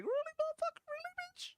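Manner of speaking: put-on very high child's voice, indignant; angry little kid. Reacting to a hungry child in the video, very high kid voice. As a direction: squeak it out like an outraged kid. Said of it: "This little kid's like".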